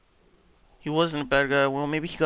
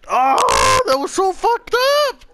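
high, tearful outburst; distraught wail; 11 semitones higher, 4 dB louder than usual. Real, not acted. Gamer appalled by the death scene, distraught wail. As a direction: cry it out, voice climbing high.

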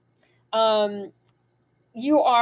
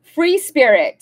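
announced loudly, a touch higher; card title read out; 4 semitones higher, 8 dB louder, slower than usual. Real, not acted. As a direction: read the card name out proudly. Reading the card title aloud.

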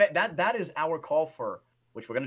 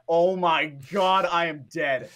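slow, louder dismayed groan; mock despair; 2 semitones higher, 7 dB louder, slower than usual. Player realizing he's trapped, slow mock despair. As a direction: drag it out like you're doomed. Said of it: "I'm like".

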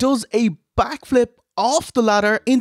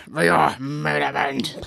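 announcer; 5 semitones lower, 4 dB quieter than usual. Pretend. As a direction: stretch it out like a ring announcer. Announces the main event.

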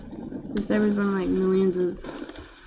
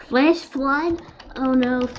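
startled; 6 semitones higher, 5 dB louder, slower than usual. Real, not acted. Flash flood announced.

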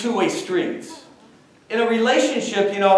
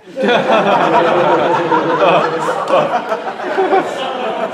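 long laugh; 6 dB louder than usual. Real, not acted. Laughing at own story.

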